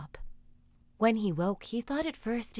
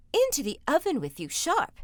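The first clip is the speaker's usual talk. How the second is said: fast, high, urgent hurry; urgent cry; 6 semitones higher, 4 dB louder than usual. Acted. Ogre's wife hiding Jack from the ogre, urgent cry. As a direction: rush him into the oven. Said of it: "cried the ogre's wife".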